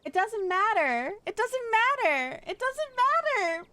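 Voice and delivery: put-on rising whine of protest over the accent; building whiny protest. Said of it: "so I can better judge the accent".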